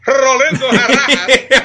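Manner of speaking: put-on name the cousin like a Spanish-accent newscaster; playful Spanish newscaster accent.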